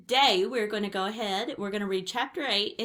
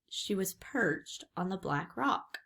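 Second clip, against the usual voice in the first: plain reading voice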